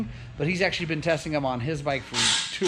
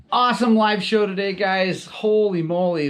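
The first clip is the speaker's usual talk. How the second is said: bursting with excitement after the live show; louder and higher, buzzing with joy; excited; 5 semitones higher, 7 dB louder than usual. Real, not acted. Host thanking viewers after the show, excited and loud.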